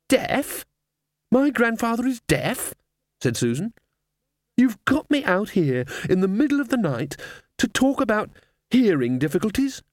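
put-on high incredulous girl's voice; indignant girl. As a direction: scold like an exasperated teenager. Susan indignant.

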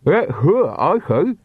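talking through a mouthful of lemons; muffled by lemons in mouth; mouth full. Acted. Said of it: "a bag of musty lemons in her mouth".

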